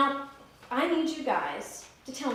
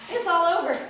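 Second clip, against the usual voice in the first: higher, louder laughing triumph; gloating rabbit; 7 semitones higher, 8 dB louder than usual. Acted. Rabbit after tricking the lion, laughing and louder. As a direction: laugh it off like a job well done.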